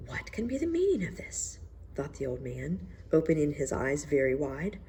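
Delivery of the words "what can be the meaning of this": high, breathy shocked whisper; whispered to himself in disbelief. Acted. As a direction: gasp it under your breath, stunned. Old minister thinking in shock.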